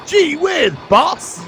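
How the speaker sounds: put-on high squeaky eager kid voice; gee whiz kid. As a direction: gush it like a wide-eyed sidekick. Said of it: "He's going".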